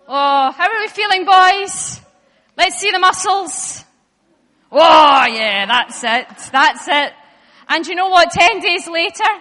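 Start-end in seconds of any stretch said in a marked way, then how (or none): 0.1-7.1 hyped-up cheering. Pretend. Hyping the boys' muscles.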